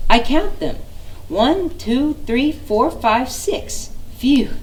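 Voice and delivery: high, lightly voiced counting squirrel. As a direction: count proudly, high, then puff out.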